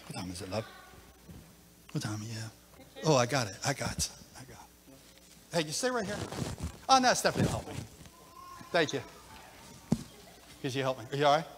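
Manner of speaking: quiet words away from the mic; murmured off-mic asides. Real, not acted. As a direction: talk aside to the helpers.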